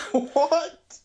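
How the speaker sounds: surprised. Real, not acted. Surprised by odd claim.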